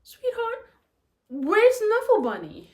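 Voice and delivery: high, loving mum asking gently; sweet mom voice. Shown as a demonstration. Mom asking her daughter about the toy, high and sweet. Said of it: "add in some ad libs".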